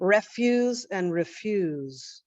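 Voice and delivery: "refuse" as REFuse then reFUSE, slowly; slow stress contrast REFuse, reFUSE; shifted stress. Shown as a demonstration. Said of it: "the stress is on ... a different syllable".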